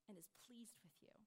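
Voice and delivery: fading into a whisper; soft unvoiced trailing question. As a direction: breathe the question out, almost no voice. Doubting believer's quiet question.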